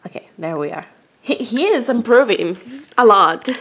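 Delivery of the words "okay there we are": low unvoiced whisper. Real, not acted. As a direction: hush so the cat stays calm. Soft whisper to cat.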